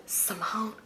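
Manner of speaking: hushed imitation of a secretive caller; whispering. Auntie calling Simone on the phone, imitated whisper. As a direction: say the name very softly, as a whisper. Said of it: "she's whispering".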